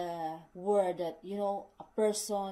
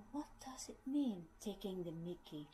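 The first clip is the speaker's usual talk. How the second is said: puzzled whisper; 10 dB quieter than usual. Retold or imitated. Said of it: "And I would say".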